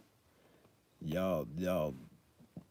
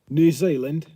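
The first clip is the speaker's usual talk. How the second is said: bad Kiwi accent; 6 semitones higher, 10 dB louder, slower than usual. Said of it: "that was probably a really bad accent".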